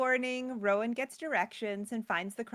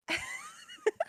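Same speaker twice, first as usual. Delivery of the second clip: high squeal of laughter. Real, not acted. Laughing at pie lady witch.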